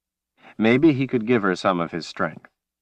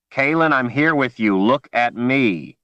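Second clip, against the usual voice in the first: urgent plea; 5 semitones higher, 3 dB louder than usual. Acted. Man pleading with panicked woman.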